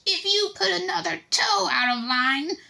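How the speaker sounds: very high, slow shrill mimic; shrill mock mother. A narrator's reading. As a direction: mimic a nagging mum shrilly. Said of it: "shrill, piercing voice".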